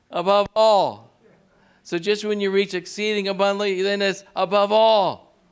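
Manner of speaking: high, loud and rising preacher's call; building sermon climax, calling out to the congregation; fired-up preaching. Acted. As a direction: lift the church with every phrase. Preaching at full force.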